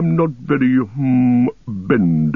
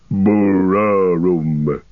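drawn-out rumbling Bararum like an organ discord; deep rumbling noise; 2 semitones lower, much slower than usual. A narrator's reading. Treebeard rumbles his Entish word for the hill, very slowly. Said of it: "a deep rumbling noise like a discord on a great organ".

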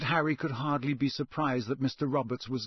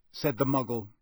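ordinary delivery

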